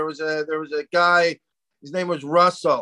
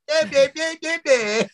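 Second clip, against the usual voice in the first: high mocking "meh-meh-meh" noise; mocking babble; 10 semitones higher than usual. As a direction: mimic their talk as high nonsense syllables. Mimics partner's speech, mockingly.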